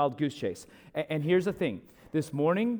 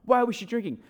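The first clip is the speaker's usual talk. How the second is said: squeaked-up mock indignation; mock yelling; 7 semitones higher than usual. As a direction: imitate a heated protest without the volume. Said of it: "he's, like, yelling at me".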